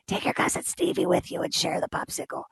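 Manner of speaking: raspy whispered Sicilian grandpa voice, breathy and hushed; thick Sicilian accent. Retold or imitated. Grandpa tells the boy to share his popsicle, hushed Sicilian accent. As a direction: imitate a hoarse old Sicilian grandfather through a throat hole. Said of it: "He would say in his very thick Sicilian accent".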